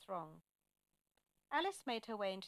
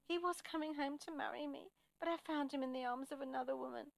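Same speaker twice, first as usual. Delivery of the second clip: high-pitched, mildly tearful voice; a touch of high, plaintive distress; tearful, between sobs; 6 semitones higher than usual. A narrator's reading. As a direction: read it as an understated sobbing lament. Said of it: "in between sobs, she said".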